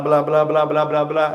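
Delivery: usual speaking voice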